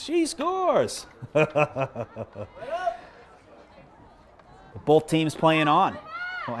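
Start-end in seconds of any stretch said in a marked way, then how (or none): 0.0-1.1 very high mimicked shout at talking volume; self-mocking squeal of a goal call; mock goal yell. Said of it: "I'm yelling at the top of my lungs". 1.1-3.0 long run of chuckles at own mistake; real embarrassed on-air laughter; self-amused laugh. Real, not acted. Laughs at own premature goal call.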